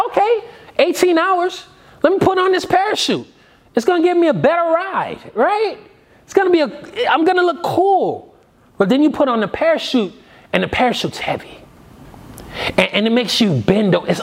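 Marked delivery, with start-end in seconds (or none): 0.1-8.4 high-pitched, eagerly agreeable passenger. Acted. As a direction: play the pleased flyer, voice pitched well up. Acting the eager parachute passenger.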